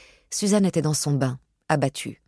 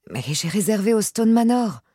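hurt retort, pitched up; réplique blessée; 6 semitones higher, 3 dB louder than usual. A narrator's reading. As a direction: protest as the wounded fiancée, higher voice. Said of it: "rétorqua Suzanne blessée". (protestation blessée, voix plus aiguë)

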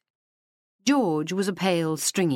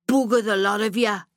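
higher, louder half-yell; drunken yell; 7 semitones higher, 4 dB louder than usual. Acted. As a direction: try to yell, but drunk. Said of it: "she tried to yell".

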